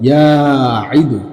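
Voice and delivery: ইয়াঈদু drawn out very slowly; teacher slowly modelling ইয়াঈদু; slow pronunciation demo. Shown as a demonstration. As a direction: stretch ইয়াঈদু so students hear each sound. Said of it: "আমরা এভাবে যদি বলি".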